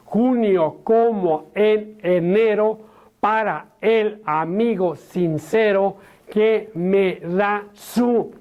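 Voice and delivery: every stressed vowel in "Cultiva una rosa blanca" pushed hard, slow and over-articulated; exaggerated accents. Shown as a demonstration. Teacher demonstrating the poem with exaggerated gesturing, over-stressed and slow. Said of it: "voy primeramente a gesticular".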